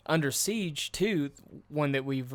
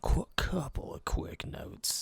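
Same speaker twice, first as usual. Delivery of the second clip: whisper; 4 semitones higher, 4 dB quieter, slower than usual. Real, not acted. Whispered aside.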